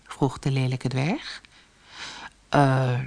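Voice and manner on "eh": reluctant king lost for words; understated flustered hesitation; hesitant stammer. A narrator's reading. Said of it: "stamelde de koning".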